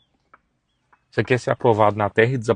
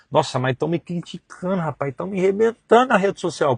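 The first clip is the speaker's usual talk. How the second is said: higher complaining tone; mock complaint; 8 semitones higher than usual. Preacher imitating a believer complaining of criticism, higher. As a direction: moan as someone feeling persecuted.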